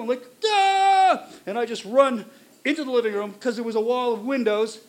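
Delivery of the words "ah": long, high, loud "ah"; retold panic scream. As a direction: scream past the question in panic.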